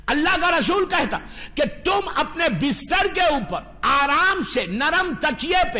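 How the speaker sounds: normal talking voice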